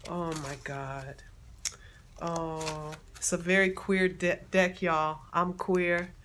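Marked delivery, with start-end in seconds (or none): none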